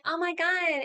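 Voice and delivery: put-on high, gushing girlish voice; mock swooning princess. Mocks Rey as a swooning princess.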